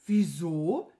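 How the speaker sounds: low start, rising innocent query; innocent question. A narrator's reading. Servant playing dumb to the angry wizard, innocently asking. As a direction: ask it as if clueless.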